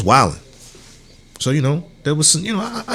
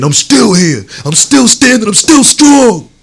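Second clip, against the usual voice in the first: rising, louder and higher with each line; defiant shout; 10 semitones higher, 7 dB louder than usual. Retold or imitated. Survivor shouting to the world, defiant and climbing. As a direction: shout it out like you survived it all.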